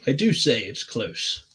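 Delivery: slow, drawn-out British accent. Shown as a demonstration. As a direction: draw it out in exaggerated British. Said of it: "I just read that in a British accent".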